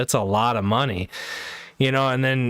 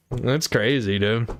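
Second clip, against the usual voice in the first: ordinary talk, nothing marked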